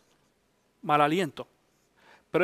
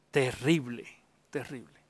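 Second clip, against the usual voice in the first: fading dread; 9 semitones lower, 7 dB quieter than usual. Retold or imitated. Marta fears the stench.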